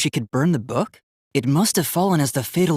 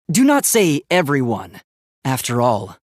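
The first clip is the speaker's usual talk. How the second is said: ordinary delivery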